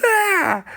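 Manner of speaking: high loud scream of fright; frightened scream. Retold or imitated. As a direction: scream like you're being chased.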